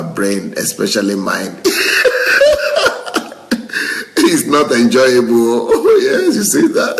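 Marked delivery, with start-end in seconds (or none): 1.6-4.2 high breathless burst of laughter; big laugh. Real, not acted. Laughs at viewer's comment. 4.3-7.0 laughing. Real, not acted. Laughing after viewer's joke.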